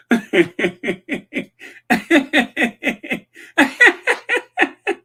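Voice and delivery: high evil cackle, loud and long; diabolical laugh. Acted. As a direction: mad scientist evil genius cackle. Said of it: "That's my diabolical laugh".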